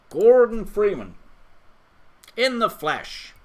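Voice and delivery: loud, high showman's reveal; grand presentation. Pretend. Streamer unveils Gordon Freeman to the viewers, grand and loud. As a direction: present him with big mock fanfare.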